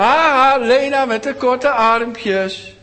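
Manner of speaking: high, loud, slow jeering laugh into the taunt; mocking laughter. A narrator's reading. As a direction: laugh her down like a bully. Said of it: "lachten ze haar uit".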